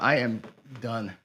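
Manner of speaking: ordinary talk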